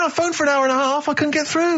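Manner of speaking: mock whine. Whining about failing to get tickets.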